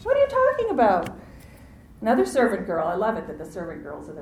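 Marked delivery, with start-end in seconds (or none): 0.0-1.1 very high, loud mock bafflement; feigned ignorance. Preacher mimics Peter playing dumb, in hammed-up feigned ignorance. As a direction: squeak it out, hammed-up innocent surprise. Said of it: "Vain ignorance".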